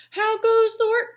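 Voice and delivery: loud, high farmer's call. Acted. Farmer calling out to duck.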